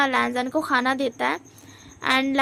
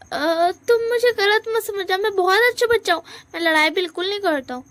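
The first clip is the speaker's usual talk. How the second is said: high fast flustered protest; high-pitched hurried excuse; nervous babble; 6 semitones higher, 4 dB louder than usual. Acted. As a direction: talk fast, high, covering up. Wing nervously protests innocence.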